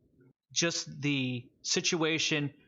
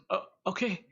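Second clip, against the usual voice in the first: hesitant acceptance; 6 semitones higher than usual. Acted. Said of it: "And he just goes".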